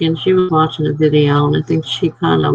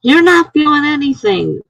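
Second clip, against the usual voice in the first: higher, mock scolding nurse; imitating the impatient nurse, pitched up; mock nurse yelling; 10 semitones higher than usual. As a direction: snap at the patient like the nurse did. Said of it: "And she's like".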